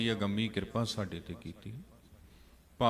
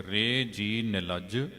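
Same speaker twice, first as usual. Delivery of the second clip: scripture recitation; 4 dB louder, much slower than usual. Acted. Recites scripture line slowly.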